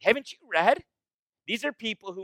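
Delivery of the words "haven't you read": incredulous question. Retold or imitated. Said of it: "that's the intent".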